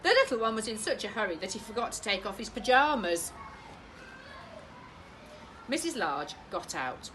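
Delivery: ordinary delivery, nothing marked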